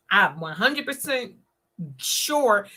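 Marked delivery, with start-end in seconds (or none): none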